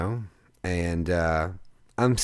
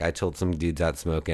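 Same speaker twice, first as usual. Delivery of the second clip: usual speaking voice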